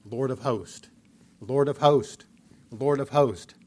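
"Lord of hosts" spaced out and struck hard; triple emphasis. Shown as a demonstration. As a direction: hammer "Lord of hosts" three times. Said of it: "emphasized three times here is".

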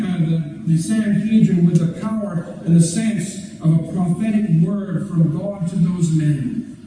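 ordinary delivery

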